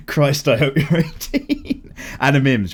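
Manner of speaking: slow, laughing through the words; real laugh breaking in, drawn out; laughing at a fan's cheeky message. Real, not acted.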